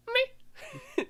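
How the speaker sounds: very high little noise; mock dismissive squeak. Witches brush off Adonis's reproach, mock high-pitched meh. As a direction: imitate a tiny, high-pitched shrug.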